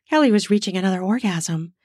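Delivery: plain reading voice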